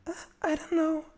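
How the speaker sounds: high falsetto lady voice; man doing a woman's voice. Pretend. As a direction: squeak it out as a lady. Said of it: "can you do a lady voice?".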